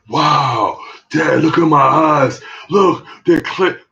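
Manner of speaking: playing the kid showing off cured eyes; amazed kid. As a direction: son marveling at his clear eyes, slow and high. Son amazed his eyes cleared.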